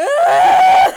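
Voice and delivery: overwhelmed fan's cry; cry-shout. Real, not acted. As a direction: burst out in a tearful shriek. Crying out at the news.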